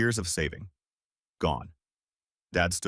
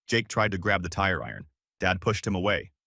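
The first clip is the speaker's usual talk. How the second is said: plain reading voice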